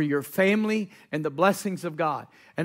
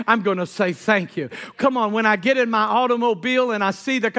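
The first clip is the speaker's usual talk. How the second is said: building, louder rhythmic preacher cadence; fervent preaching; 5 semitones higher, 4 dB louder than usual. Real, not acted. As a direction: drive each thanks home to the congregation.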